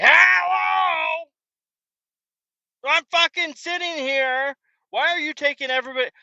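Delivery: high, loud indignant shout that settles; exasperated yell. Retold or imitated. Fed-up customer yelling at the drive-through window. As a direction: yell at the window like you've been forgotten. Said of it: "and you're like".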